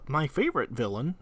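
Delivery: ordinary delivery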